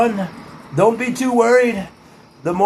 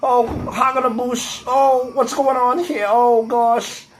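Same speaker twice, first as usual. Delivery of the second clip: slow, higher exaggerated kvetch; hammed-up comic voice; comic character voice; 4 semitones higher, slower than usual. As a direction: drawl out the complaint. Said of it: "I got a".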